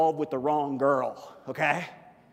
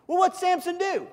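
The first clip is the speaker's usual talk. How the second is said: usual speaking voice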